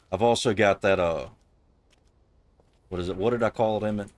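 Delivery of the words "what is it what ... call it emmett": ordinary delivery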